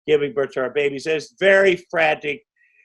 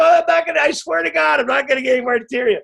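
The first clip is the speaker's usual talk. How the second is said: pleading shout; 10 semitones higher, faster than usual. Retold or imitated. Actor swears to his nervous co-star he won't land the punch. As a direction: shout a promise in earnest. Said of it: "I go".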